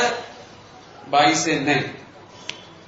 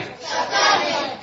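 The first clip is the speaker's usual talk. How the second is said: drawn-out high answer; 11 semitones higher, much slower than usual. Real, not acted. Answering his own quiz question.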